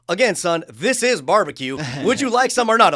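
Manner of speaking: hammed-up impatient old Southerner repeating himself; the pitmaster tells the guest again: slow, loud mock impatience. Southern pitmaster losing patience, retold.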